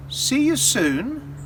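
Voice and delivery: slow, teasing devil farewell; ominous farewell. Acted. Devil leaving the inn, slow teasing farewell. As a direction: draw it out with a wink.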